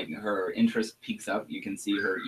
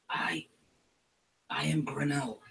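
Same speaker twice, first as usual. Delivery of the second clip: a sly shaman sharing a secret grudge; goblin shaman whisper. Acted. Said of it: "And she says".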